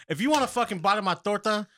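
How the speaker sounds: high-pitched, worked-up offer; fed-up, insistent ranting; exasperated bargaining. Acted. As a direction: plead your case, pitched well up. Hypothetical rant about guarding his torta.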